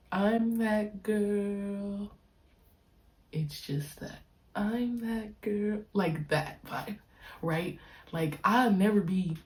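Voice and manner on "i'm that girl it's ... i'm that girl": very slow emphatic declaration. Real, not acted. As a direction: savour each word of the claim. Declaring self-confidence, drawn out.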